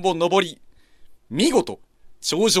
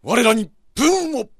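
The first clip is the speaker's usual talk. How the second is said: samurai battle cry; 7 semitones higher, 7 dB louder than usual. Acted. Warrior's battle prayer.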